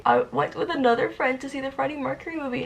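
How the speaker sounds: usual speaking voice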